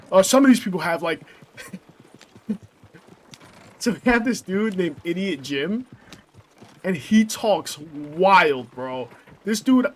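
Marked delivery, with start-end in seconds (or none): none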